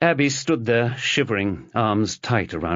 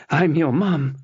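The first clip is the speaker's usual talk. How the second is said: tearful; 7 semitones higher than usual. Acted. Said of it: "tears streaming down her cheeks".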